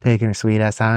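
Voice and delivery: ordinary talk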